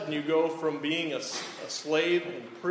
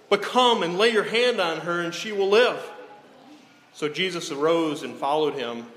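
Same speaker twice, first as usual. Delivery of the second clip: louder, declaiming Bible reading; scripture reading; 6 dB louder than usual. Acted. Preacher reading Matthew 9 to the congregation, declaiming. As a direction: proclaim the passage to the congregation.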